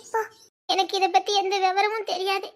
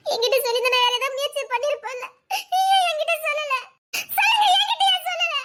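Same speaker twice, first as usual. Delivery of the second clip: rising to a much higher, louder tearful yell; crying shout; 5 semitones higher, 3 dB louder than usual. Acted. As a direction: scream the accusation through sobs. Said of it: "கத்தாதடி". (அழுகையுடன் கத்தல்)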